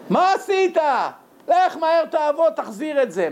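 high-pitched, scolding father; angry father. Acted. אב כועס על בנו שבזבז. (אבא כועס ונוזף, בקול גבוה)

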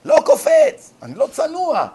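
high, loud, indignant protest; mock stubborn refusal. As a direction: refuse to jump, mock piety. Husband refusing to jump. (סירוב עקשן מדומה, גבוה וקולני)

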